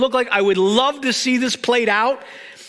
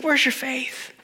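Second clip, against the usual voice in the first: quiet gentle question; 6 dB quieter than usual. Retold or imitated. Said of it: "he's like".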